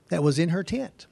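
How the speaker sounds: ordinary talk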